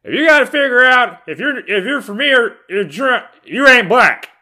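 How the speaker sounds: very high, loud mock of Joe Biden; high cartoonish Biden impression. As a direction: parody the politician's line in a squeaky voice. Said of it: "Joe Biden said".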